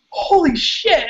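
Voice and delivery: retold horrified realization, pitched high. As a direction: gasp it out as if suddenly seeing yourself. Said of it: "I just got horrified".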